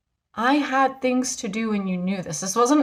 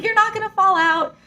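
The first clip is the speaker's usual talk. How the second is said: put-on high, bright-eyed kid reassuring a grown-up; little kid voice; 9 semitones higher than usual. Kid on the ride telling her she'll be fine, high child voice. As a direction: pitch way up like a confident child. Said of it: "He's like".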